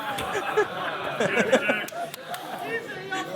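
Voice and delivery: high, trailing real laugh; long laugh. Real, not acted. Reactor still laughing after the pizza bar. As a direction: keep laughing, can't stop.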